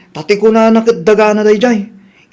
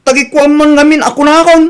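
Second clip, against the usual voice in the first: heated retort; 5 semitones higher, 4 dB louder than usual. Acted. Son and neighbour quarrel.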